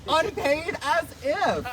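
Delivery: high, drawn-out scoff; mock outrage. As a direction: stretch it out high and loud in disbelief. Acting outrage at unpaid panels.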